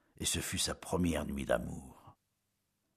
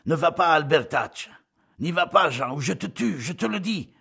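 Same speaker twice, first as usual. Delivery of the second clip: much higher, loud yelled warning; shouted threat; 10 semitones higher, 9 dB louder than usual. Acted. Mathieu to Jean, a shouted death threat. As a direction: bellow the threat across the path. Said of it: "cria-t-il".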